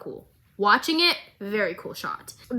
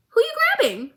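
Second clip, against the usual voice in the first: much higher, sing-song "Who you grabbing?"; childlike question; faster than usual. Retold or imitated. Jinx asking the bartender, high childlike lilt. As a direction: lilt it up like a curious kid.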